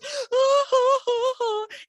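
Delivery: high, breathy fake gasp; hammed-up fake sob gasp; mock gasp. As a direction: gasp like you're pretending to cry. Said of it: "Then she goes".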